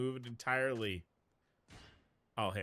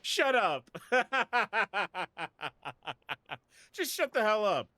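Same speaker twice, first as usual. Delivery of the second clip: laughing shout; 8 semitones higher, 7 dB louder than usual. Real, not acted. Streamer reacting to game line.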